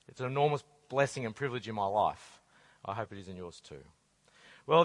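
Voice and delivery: ordinary delivery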